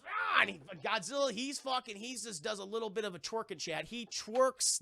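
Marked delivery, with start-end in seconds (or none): 0.1-0.4 high battle yell; mock roar. Godzilla roaring as the fight starts. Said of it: "and he goes".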